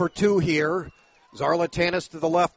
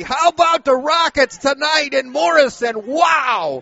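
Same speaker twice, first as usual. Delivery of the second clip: louder, higher celebratory call; excited shout; 7 semitones higher, 7 dB louder than usual. Real, not acted. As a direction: celebrate the drive out loud. Announcer celebrates the drive again.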